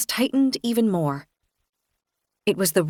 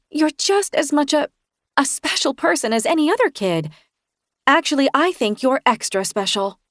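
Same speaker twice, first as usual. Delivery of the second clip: voice breaking; 6 semitones higher than usual. Acted. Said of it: "my voice breaking".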